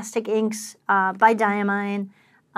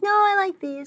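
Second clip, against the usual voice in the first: mock stubborn husband; 10 semitones higher than usual. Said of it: "And you're like".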